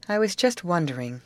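plain reading voice

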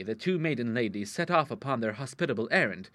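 ordinary delivery, nothing marked

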